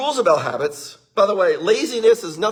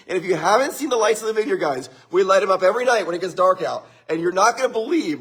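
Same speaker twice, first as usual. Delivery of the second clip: ordinary talk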